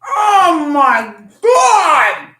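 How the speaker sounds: loud, slow, laughing Oh my God; ecstatic shout. Real, not acted. First bite of the cookie, on camera, shouting with delight. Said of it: "I don't know how you do it".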